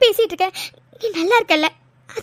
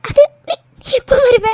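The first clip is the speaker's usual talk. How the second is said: louder tearful question; crying; 3 semitones higher, 4 dB louder than usual. Acted. Girl asking her fiancé when he returns, crying. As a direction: sob it out. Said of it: "முதல்ல அலறதை நிறுத்துங்க".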